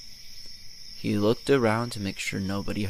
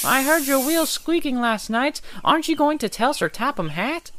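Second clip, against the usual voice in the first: much higher little engine voice; little engine taunting big Gordon about his squeak; cheeky Percy. Acted. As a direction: needle him with sly glee.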